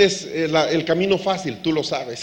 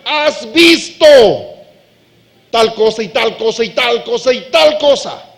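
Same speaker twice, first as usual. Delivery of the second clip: urgent plea; 3 semitones higher, 7 dB louder than usual. Acted. David pleading to God.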